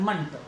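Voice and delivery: मंट said low, with short "uh"; reduced-vowel "munt". Shown as a demonstration. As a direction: drop the pitch on मंट, "muhnt". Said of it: "मंट बोलना है".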